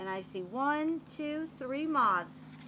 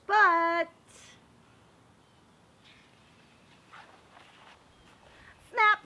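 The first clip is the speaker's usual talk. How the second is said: dramatic snap; 8 semitones higher, 12 dB louder than usual. Acted. Dramatic turn in story.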